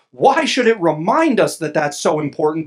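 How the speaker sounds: ordinary delivery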